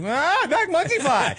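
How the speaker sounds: high, drawn-out mock awe; amazed kids. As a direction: imitate children thrilled by a balloon animal. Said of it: "they were like".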